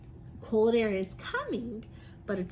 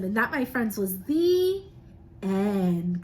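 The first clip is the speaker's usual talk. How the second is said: rising, louder grand ending; big finish; 4 dB louder, slower than usual. Acted. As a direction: land the ending with a flourish.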